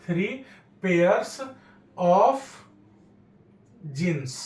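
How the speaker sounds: long pauses between three, pairs, of, jeans; word-by-word dictation. Shown as a demonstration. Teacher dictating the correction to students, one word at a time. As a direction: rise on each word, drop on jeans.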